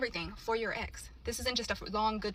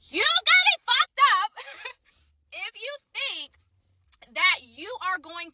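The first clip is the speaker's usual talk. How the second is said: high, drawn-out loud exclamation; incredulous, laughing, slow and emphatic; indignant outburst; 10 semitones higher, 6 dB louder, slower than usual. Real, not acted. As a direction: stretch it out in high-pitched disbelief with a laugh. Indignant rant at the absent man.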